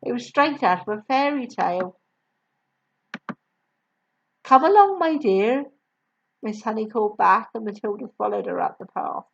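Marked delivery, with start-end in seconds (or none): 4.4-5.6 put-on higher, louder kindly young woman; gentle teacher voice. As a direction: call out sweetly like a kind teacher. Miss Honey calling out.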